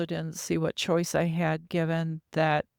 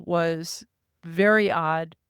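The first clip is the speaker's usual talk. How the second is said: ordinary talk, nothing marked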